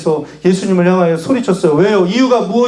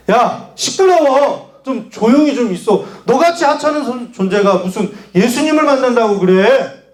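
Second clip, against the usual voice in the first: high-pitched scornful telling-off; scolding; 5 semitones higher than usual. Acted. Crowd hushes the blind beggar, scolding and scornful. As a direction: scold him to shut up, voice jumping high. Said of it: "사람들이 그렇게 꾸짖는데도".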